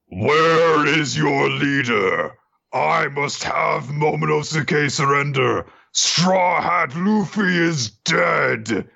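high, slow, booming dragon roar; dragon Kaido booming. Retold or imitated. Dragon Kaido announcing his victory to the Alliance, slow and booming. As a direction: drag each word out like a giant dragon. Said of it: "comes down and says".

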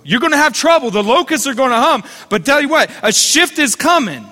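lifted, ringing preacher's cry; rally the room toward the promise; preaching at full force. Acted. Pastor rousing the congregation about coming change, a fervent preaching cry.

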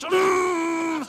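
shouted imitation of a horn; mock horn blast. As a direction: lean on the horn with your voice. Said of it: "I just lay on the horn".

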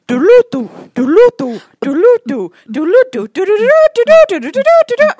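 loud, rising synth riff; imitated techno top line. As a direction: repeat it rhythmically, climbing high at the end. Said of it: "there's a top part on top of it".

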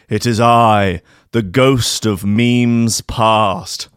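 slow, hammed-up spooky ghost; a ghost announcing itself to the sleeper; ghostly voice. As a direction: draw out each word like a haunting spirit. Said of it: "a ghostly voice".

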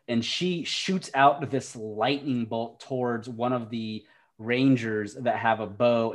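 normal talking voice

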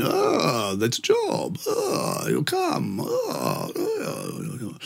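soft, rumbling groaned mimicry; imitated big man. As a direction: groan it out like a huge bear of a man.

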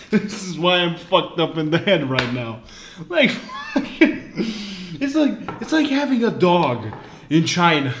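high-pitched talk through real laughter; laughing. Real, not acted. Comic blaming the lamb story for his issues, helpless laughing. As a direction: push the words out through laughs.

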